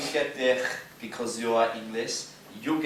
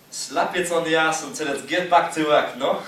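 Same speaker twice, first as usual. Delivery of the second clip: loud punchline; 5 semitones higher, 10 dB louder than usual. Acted. Punchline of a sexist joke.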